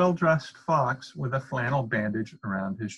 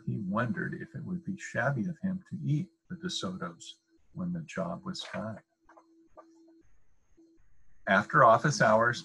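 usual speaking voice

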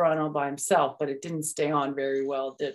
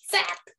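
high zap sound; 13 semitones higher than usual. A narrator's reading.

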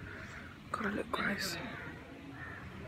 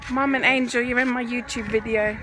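loud, raised call across the room; 3 semitones higher, 8 dB louder than usual. Real, not acted. Telling mum and Angel they're being filmed, calling out. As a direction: call out to get their attention.

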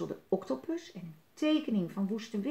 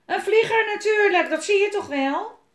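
loud, high pirate outburst; indignant exclamation; 12 semitones higher, 12 dB louder than usual. A narrator's reading. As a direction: cry out as if it is obvious. Willem indignantly shows his kite. (verontwaardigd, hoog en luid)

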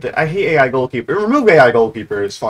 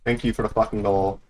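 lower, softer, understated; low dry thanks; 5 semitones lower, 6 dB quieter than usual. Real, not acted. Gamer thanking for a goal, low dry thanks. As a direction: say it down low and quietly.

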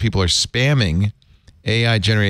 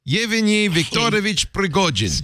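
hammed-up Russian accent, higher pitch; 8 semitones higher than usual. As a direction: roll out the full name in a mock Russian accent. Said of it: "I was using Russian accent".

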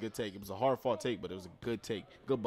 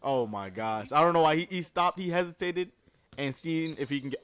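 usual speaking voice

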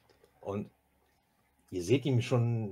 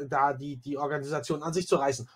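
normal talking voice

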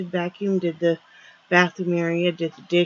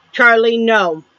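sharp, louder no to the dog; firm scold; 3 semitones higher, 6 dB louder, slower than usual. Real, not acted. Owner scolding her dog Charlie, firm loud no.

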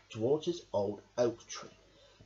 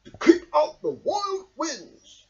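Badger speaking; 14 semitones higher, 9 dB louder, slower than usual. A narrator's reading. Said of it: "he said".

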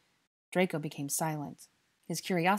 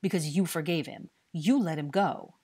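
loud, slowing accusation; angry growl; 6 dB louder than usual. A narrator's reading. Dennis blames Harry for letting him go, angry. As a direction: accuse him with resentful force. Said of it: "Dennis growled angrily".

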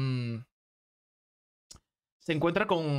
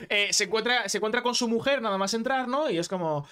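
ordinary delivery

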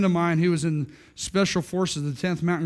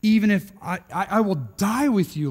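higher, punched vow of loyalty; emphatic; 3 semitones higher than usual. Acted. Peter answering Jesus's warning, emphatic. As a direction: insist on it, hitting the words hard. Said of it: "says emphatically".

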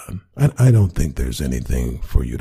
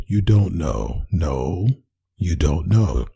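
ordinary talk

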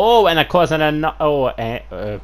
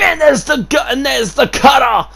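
excited shout; 8 semitones higher than usual. Real, not acted. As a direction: shout the cutter like it's the end. Commentator calls the finisher.

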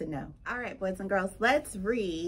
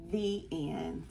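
ordinary delivery, nothing marked

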